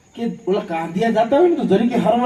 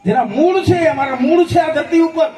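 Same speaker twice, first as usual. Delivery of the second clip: proud proclamation; 7 semitones higher, 3 dB louder than usual. Acted. Proclaims roots to audience.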